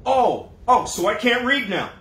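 loud, high mock indignation; hammed-up huffy comeback; sarcastic offended. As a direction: play the wounded victim, sarcastically. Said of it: "You might say".

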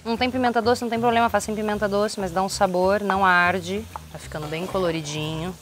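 ordinary delivery

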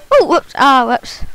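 ordinary delivery

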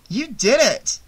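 high, delighted affirmation; happy agreement. A narrator's reading. Vera seeing Shelley in a new light, happy agreement. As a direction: cheer him on happily. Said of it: "Vera agreed happily".

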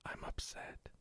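soft unvoiced whisper. Real, not acted. Player sulking at the game, whispered. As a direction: whisper it to yourself, defeated.

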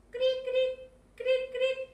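high, loud "cric, cric" cricket imitation; mock cricket chirps. Teacher mimics crickets when students stay silent, high mock chirping.

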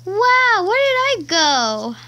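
high, loud amazed cry; loud surprise. Real, not acted. Kid teleported in game, loud amazed wow. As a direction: blurt it out amazed. Suddenly teleported somewhere new.

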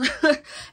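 short burst of amused laughter; quick high chuckle. Real, not acted. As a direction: laugh briefly at your own point. Amused by her own point.

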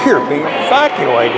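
usual speaking voice

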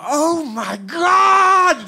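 high, very slow, loud squeal of awe; high, very slow, loud daughter squealing in awe; hammed-up amazed shout. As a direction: shout it out like a stunned teen.

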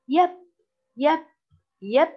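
"يب" loud and short, closed on the b; closed syllable. Shown as a demonstration. Teacher isolates the silent-letter syllable, short loud beats. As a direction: punch out "يب" as separate short beats. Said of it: "هذا مقطع ساكن".

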